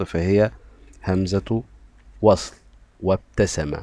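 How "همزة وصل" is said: ordinary talk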